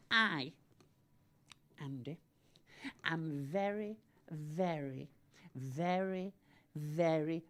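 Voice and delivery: very slow, deliberate repetitions with pauses; drawn-out boast. Pretend. Boasting to Andy about fame, slow and drawn out. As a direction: stretch out each 'very' before the reveal.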